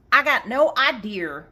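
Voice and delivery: put-on playful Southern accent punchline. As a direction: answer loudly in a mock Southern accent. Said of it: "In a southern accent".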